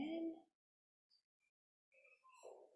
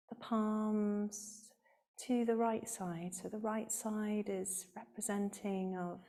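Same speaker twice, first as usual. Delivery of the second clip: calm, measured yoga teacher voice, softer than chat; guided meditation; 4 semitones lower, 8 dB quieter than usual. Real, not acted. Yoga teacher leading the class through alternate nostril breathing, calm guided meditation. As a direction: guide the breathing with long pauses between phrases.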